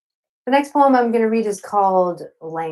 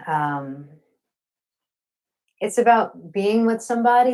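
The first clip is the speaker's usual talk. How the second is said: ordinary talk, nothing marked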